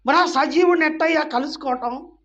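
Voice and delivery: loud, high challenge to the congregation. Real, not acted. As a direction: put the question out at full voice. Rhetorical question, then repeated quietly.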